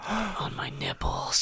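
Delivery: breathy unvoiced whisper. Real, not acted. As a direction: whisper it like a secret. Whispered joke mid-conversation.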